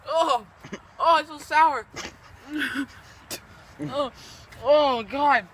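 high, loud grossed-out groaning; disgusted reaction. Real, not acted. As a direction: react to a sour taste. Tasting sour challenge mix.

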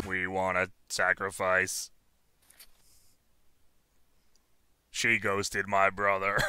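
very low, quiet demonic voice. Pretend. Deep demonic voice, game.

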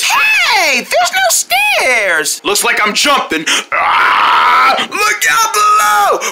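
panicked yell. Acted. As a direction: yell it while dropping down. Falls where stairs are missing.

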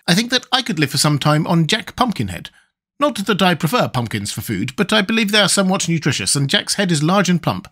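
high-pitched bug character; high, matter-of-fact storybook insect; calculating Wogglebug. Acted.

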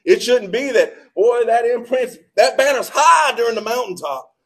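loud, high preaching build. Acted. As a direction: proclaim it to the whole church. Preacher rising to full force.

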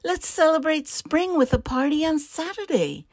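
high, loud, cheerful invitation; happy iguana. A narrator's reading. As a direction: announce the party happily, pitched well up. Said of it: "twitched her tail happily".

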